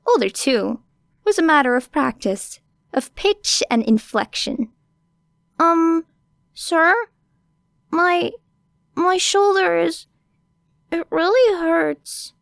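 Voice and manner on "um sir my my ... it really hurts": high, small put-on child voice; younger girl voice. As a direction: fake a hurt little kid. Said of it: "Era could sound younger than she was".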